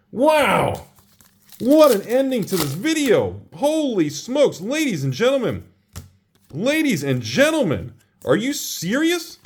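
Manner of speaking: high, thrilled exclaiming; excited. Real, not acted. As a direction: celebrate like a showman. Celebrating the end of the video.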